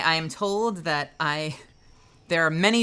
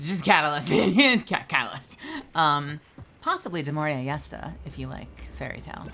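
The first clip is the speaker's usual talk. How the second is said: ordinary delivery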